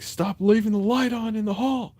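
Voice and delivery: high, loud mock yell; yelling at the roommate about the hall light; retold yell. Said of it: "sometimes I would just yell at him".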